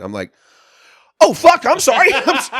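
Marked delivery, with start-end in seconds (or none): 1.2-2.5 much higher, loud giddy exclamation; suddenly realizing he's free to go; stunned excitement. Retold or imitated. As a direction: blurt it out overjoyed.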